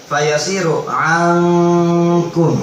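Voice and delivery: slow drawn-out tajwid chant; Quran recitation. Shown as a demonstration. Teacher chanting the verse for students, slow tajwid recitation. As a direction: recite it slowly with held nasal.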